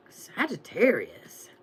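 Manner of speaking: slow whisper to build suspense; dramatic whisper. Acted. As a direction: whisper the sign like a secret. Whispered before revealing the cards.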